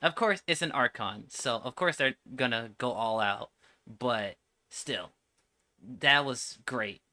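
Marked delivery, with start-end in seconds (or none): none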